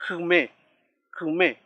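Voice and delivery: "Kume" said twice, separately, first vowel coloured by K; vowel after consonant. Shown as a demonstration. Teacher repeats the example for learners, careful demonstration. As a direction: model "kume" with a subtle sixth vowel after K. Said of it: "toma ese sonido".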